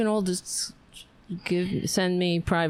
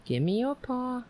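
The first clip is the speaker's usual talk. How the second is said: high-pitched pet talk; high coaxing; 6 semitones higher, 3 dB quieter than usual. Shown as a demonstration. Owner repeats the new way of asking the dog, high coaxing. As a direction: coax the dog, pitched well up. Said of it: "maybe I have to say it like that".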